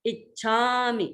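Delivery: इच्छामि with a long छा, slowly; इच्छामि with a lengthened छा. Shown as a demonstration. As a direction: stretch the छा in इच्छामि. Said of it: "तो शुद्ध हो गया".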